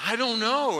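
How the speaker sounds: high, loud helpless retort; mock fluster. Preacher answering his panicked brother, mock fluster. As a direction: play it loudly at a loss.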